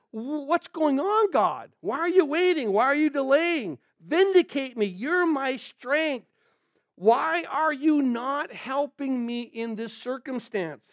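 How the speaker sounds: high, drawn-out complaint to God; exasperated plea. Acted. Preacher voices the psalmist questioning God, exasperated plea. Acted anguished challenge to God.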